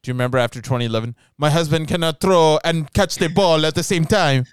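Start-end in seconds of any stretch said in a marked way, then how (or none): 1.4-4.4 exaggerated European-tinged accent, louder; mock Gisele accent, loud and put-on; Gisele impression. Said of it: "why do you make her sound like Melania Trump".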